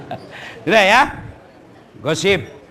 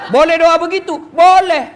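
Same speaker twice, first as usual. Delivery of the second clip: loud question; 8 semitones higher, 6 dB louder than usual. Acted. Calling out to the audience.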